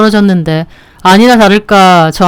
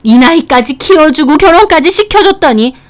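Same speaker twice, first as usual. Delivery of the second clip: a hammed-up furious mother; enraged mother-in-law; 7 semitones higher than usual. (높은 톤으로 분노하는 장모님)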